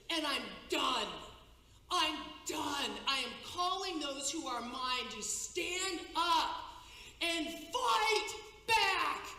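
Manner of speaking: slow, loud, booming divine wrath; roaring voice of God. Retold or imitated. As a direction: thunder it out like an angry God. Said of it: "thundering voice of God just roaring".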